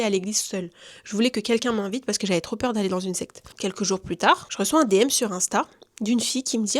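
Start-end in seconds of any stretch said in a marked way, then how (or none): none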